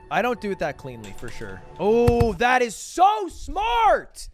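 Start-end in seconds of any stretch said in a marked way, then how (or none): 1.8-4.3 high, loud amazed shout; excited shout. Real, not acted. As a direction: blurt it out in delight. Amazed by clever strat.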